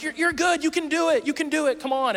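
high, eager encouragement; rally the shy singer; cheering on. Retold or imitated. Whole church cheering him on, high and eager.

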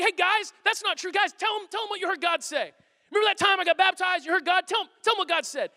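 high, urgent appeal to friends; hammed-up needy protest; pleading for backup. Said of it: "get other people to speak up for him".

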